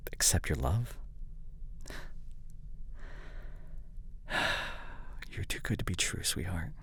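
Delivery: soft breathy whisper, close to the ear; intimate whisper roleplay. Acted. Partner whispering apologies and love, soft and hushed. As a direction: comfort a crying partner in hushed tones.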